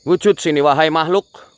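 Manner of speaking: high, loud order to appear; commanding summons. Real, not acted. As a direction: summon the unseen with force. (memanggil dengan lantang)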